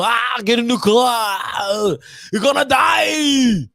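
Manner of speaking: slow, loud, high action-hero shout; hammed-up action-movie shouting; Arnold Schwarzenegger impression. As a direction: bark it out like a movie tough guy. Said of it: "Like Arnold Schwarzenegger, his main leading role".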